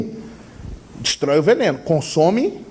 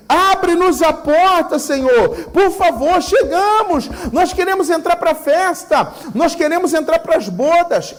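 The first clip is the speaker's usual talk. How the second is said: anguished cry for the Lord to open; pleading virgins; 8 semitones higher than usual. Acted. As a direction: plead like a bride locked out. Virgins beg to enter feast.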